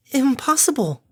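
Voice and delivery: high, indignant sputter. A narrator's reading. Fluttershy rejects the idea of a birth, sputtering. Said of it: "Fluttershy sputtered".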